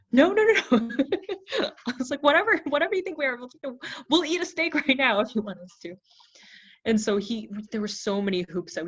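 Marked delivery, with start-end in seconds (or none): 0.1-1.0 much higher and louder, hurried nos; frantic denial. Retold or imitated. Denying to suspicious ranch owner. 1.1-1.8 high laughing burst; real laugh. Real, not acted. Laughing at own story. 2.2-5.9 much higher, louder, fast and eager; eager pleading. Retold or imitated. Eager pleading with ranch owner.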